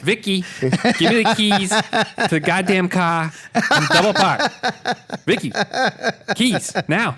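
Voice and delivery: slow, mock-angry Boston rant with a laugh; hammed-up Boston accent. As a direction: demand the keys like a hammed-up Bostonian. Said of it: "that Boston accent".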